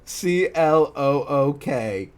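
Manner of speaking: slow letter by letter, then the word; spelled out. Shown as a demonstration. Reader puzzling over the fanfic's typo, slowly spelled out.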